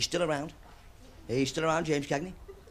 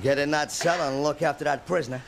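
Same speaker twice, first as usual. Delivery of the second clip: John Wayne; 3 semitones lower than usual. Retold or imitated. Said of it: "John Wayne talking to Walt Brennan".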